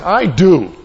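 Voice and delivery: forceful mock-furious comeback; angry retort. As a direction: fire it back as a stubborn angry man. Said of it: "This is a very angry guy".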